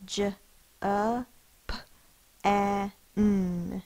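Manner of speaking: letters said one by one; sounding out. Shown as a demonstration. Teacher to class, sounding out Japan letter by letter. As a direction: sound out J, A, P, A, N slowly. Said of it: "Sound it out".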